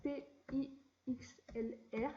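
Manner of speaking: slow, letter by letter; spelling it out. Shown as a demonstration. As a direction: spell each letter slowly and clearly for viewers. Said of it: "Alors, vous marquez".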